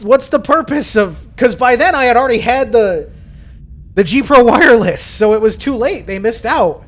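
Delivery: high-pitched, indignant venting; worked-up, high exasperated complaint; exasperated rant. Real, not acted. Exasperated rant at company's late release.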